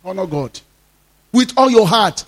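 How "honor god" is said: soft echo. Real, not acted. Repeated exhortation, echoed softly.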